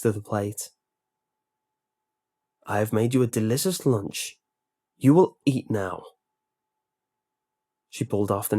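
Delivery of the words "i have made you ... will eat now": flat menacing order; hint of a threatening villain; cold command. A narrator's reading. Lunch lady ordering captive.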